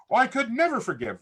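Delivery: high-pitched imitation of unforgiving people; mock refusal. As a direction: protest as those people would. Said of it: "a lot of people say".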